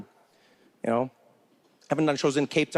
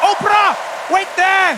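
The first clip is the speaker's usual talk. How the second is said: loud, high, slow shout at a celebrity; drawn-out commanding yell; mock street holler; 14 semitones higher, 7 dB louder, slower than usual. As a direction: call out a star across the street. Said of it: "no one overseas who's like".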